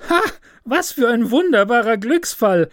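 high, bright cry of glee; delighted student. A narrator's reading. Student at his window finding the rose, high delighted cry. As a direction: crow over the lucky find. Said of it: "rief er".

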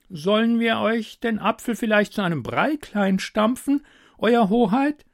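high, fussy courtier voice; king's advisor. Acted. Advisor suggests mashing the apple for the king, high and fussy. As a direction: offer it with mock servility. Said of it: "schlug der königliche Berater vor".

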